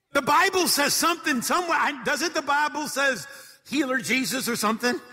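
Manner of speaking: high, loud flustered scramble; mock panicked believer. Sinking believer suddenly hunting the Bible, high mocking panic. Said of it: "they're like".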